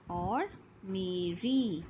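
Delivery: मेरी drawn out very slowly; slow word demo. Shown as a demonstration. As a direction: stretch मेरी so the र is heard. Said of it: "र से". (मेरी को धीरे-धीरे बोलना)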